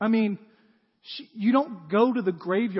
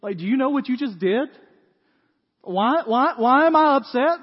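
building from a question to a loud cry; louder and higher with each why; exasperated parent; 6 semitones higher, 9 dB louder than usual. Retold or imitated. Parent to child after a mess, rising exasperation. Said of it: "And you're like".

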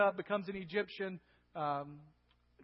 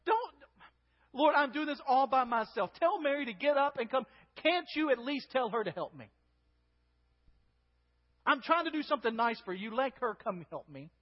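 higher, louder aggrieved plea; urgent complaint; 6 semitones higher, 5 dB louder than usual. Acted. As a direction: complain urgently as a sister left doing all the work. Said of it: "Consider the urgency in the voice".

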